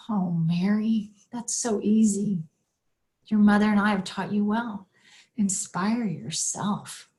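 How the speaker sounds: slow, tender imitation of a dad; calm her down slowly, as her father; retold unhurried fatherly comfort; reassuring father. Dying father reassures crying daughter.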